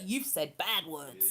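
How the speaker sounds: low, slow mock scolding; algorithm's reprimand. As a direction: voice a machine's slow telling-off. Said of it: "set the algorithm off with a".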